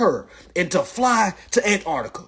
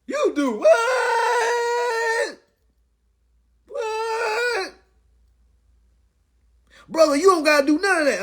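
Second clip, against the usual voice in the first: mock shocked squeal, much higher, stretching "You do what?"; shocked outsiders; much slower than usual. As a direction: play the horrified listener, shrieking high. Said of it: "For all you folks that was like".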